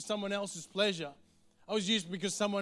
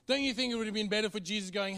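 ordinary talk, nothing marked

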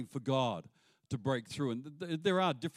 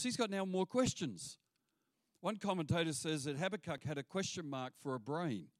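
usual speaking voice

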